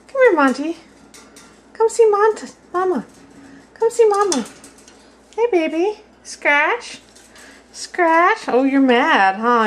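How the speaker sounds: high, sing-song coaxing of a parrot; high pet talk. Real, not acted.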